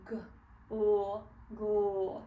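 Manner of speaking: "G, l" as separate sounds, then joined "gl"; slowed-down blend. Shown as a demonstration. Teacher practices a blend at the beginning, slowly sounding "G, l, gl". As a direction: isolate each sound of "gl" before blending. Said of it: "slow it down just a little bit".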